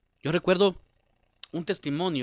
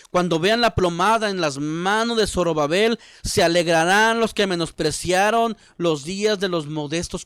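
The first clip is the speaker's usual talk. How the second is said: loud, ringing preacher's proclamation; scripture declamation; 3 semitones higher, 7 dB louder than usual. Real, not acted. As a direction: proclaim the verse to the congregation. Declaims a Bible verse.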